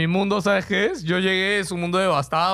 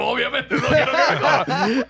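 laughing reply; 4 semitones higher than usual. Retold or imitated. Retelling his reply, laughing.

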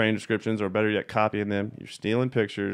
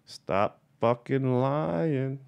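very slow and higher, with "lying" stretched long; drawn-out catchphrase; 4 semitones higher, much slower than usual. Acted. Dictating the subject line to viewers, slow drawn-out catchphrase. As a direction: space out the words and sing-song "lying".

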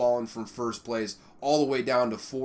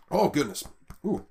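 choking on spit; 2 semitones higher than usual. Real, not acted. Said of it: "I'm choking on my own spit right now".

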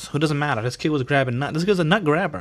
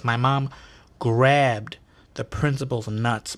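ordinary talk

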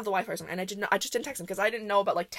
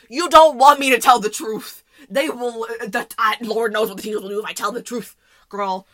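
loud retort; 11 dB louder than usual. Retold or imitated. Retold outburst to friend.

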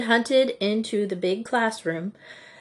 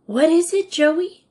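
eager question; 9 semitones higher, 5 dB louder than usual. A narrator's reading. Curious girl asks Jo.

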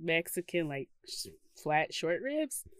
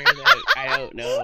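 very high, loud, laughing quote; 10 dB louder than usual. Retold or imitated. Co-host jokes about Roxy judging the singing, laughing. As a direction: voice the reaction while cracking up.

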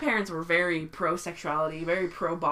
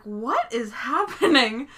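loud, slow, higher-pitched retold bewilderment; baffled mom; 5 semitones higher, 9 dB louder, slower than usual. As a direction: stretch it out like a mystified mother. Retelling mother's bafflement.

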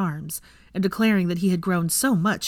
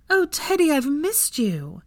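put-on high, slow, gushing over a child; delighted greeting; 9 semitones higher, slower than usual. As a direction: coo it out to a beloved little boy.

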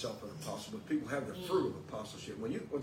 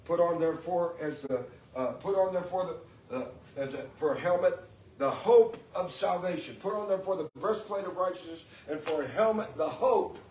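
high, loud preaching at full force; fervent preaching; 5 semitones higher, 5 dB louder than usual. Acted. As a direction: preach it out to the congregation, building fervor. Preaching the helmet of salvation.

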